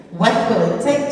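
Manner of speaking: ordinary talk, nothing marked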